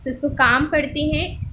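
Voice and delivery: normal talking voice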